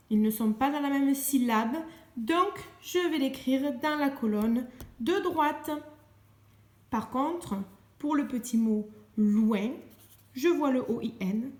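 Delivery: usual speaking voice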